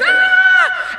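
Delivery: very high, loud wail; re-enacted scared scream. Frightened rider on the wavy end of ride, screaming. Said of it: "I'm scared like this".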